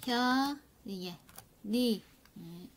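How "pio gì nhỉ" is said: Pio stretched slowly to show the linked sound; slow linking demo. Shown as a demonstration. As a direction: drag out Pio to show the liaison. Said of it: "phải nối âm lên".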